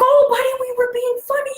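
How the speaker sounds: put-on high-pitched coo to a child; consoling parent. Parents to crying son after sarcasm, high consoling voice.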